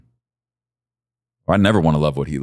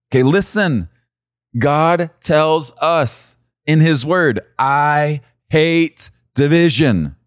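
preaching at full force; 7 semitones higher than usual. Real, not acted. Preacher to congregation, preaching at full force. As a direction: pound home God's word to the congregation.

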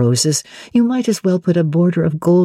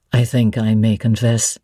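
voiced lower as the brooding master; Mr. Rochester; 6 semitones lower than usual. A narrator's reading. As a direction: drop low and weighty, wary of her question.

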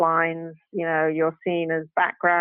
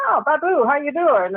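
put-on high, lilting hello to an old friend; delighted greeting; 7 semitones higher than usual. Greeting the A D who recognized her, delighted. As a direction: re-enact a happily surprised reunion. Retold surprised reunion greeting.

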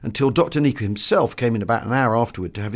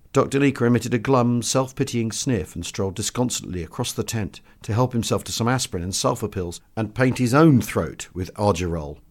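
usual speaking voice

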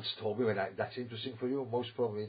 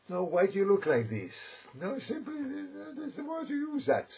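mock surprise; 13 semitones higher than usual. Real, not acted. Teasing the listener's surprised look.

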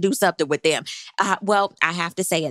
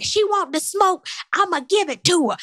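mock holler; 10 semitones higher than usual. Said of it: "but she's hollering".

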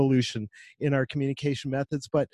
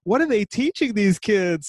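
exasperated; 7 semitones higher, 5 dB louder than usual. Retold or imitated. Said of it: "I'm like".

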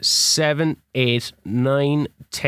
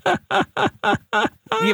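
very high, slow, cracking shout; ecstatic cry; much slower than usual. Real, not acted. Gamer roaring at his comeback lead, ecstatic cry. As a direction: howl it out, overjoyed. Celebrating going three two up.

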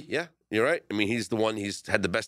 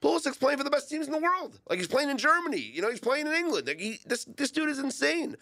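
much higher, fast, gushing praise; excited hype. Real, not acted. Host raves to co-hosts about Pulisic's clubs, high and excited. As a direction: rave about the star player.

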